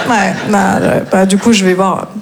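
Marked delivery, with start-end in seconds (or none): none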